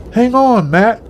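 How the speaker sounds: narrator's mild holler up to a climber. A narrator's reading. Fred to father-in-law up the ladder, hollered. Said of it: "Fred hollered as he looked up".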